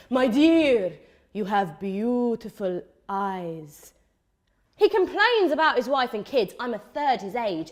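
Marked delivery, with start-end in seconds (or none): 0.1-3.9 slow, drawn-out uncle-type flattery; creepy married uncle. Acted. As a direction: mimic an older married admirer, lingering on each word. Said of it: "married uncle types who say".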